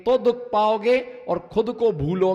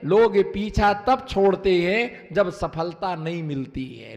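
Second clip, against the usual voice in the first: ordinary talk, nothing marked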